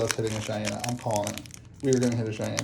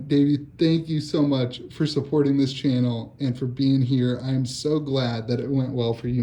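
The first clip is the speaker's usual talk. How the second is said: ordinary talk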